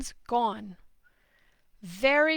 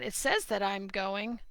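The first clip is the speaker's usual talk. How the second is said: ordinary talk, nothing marked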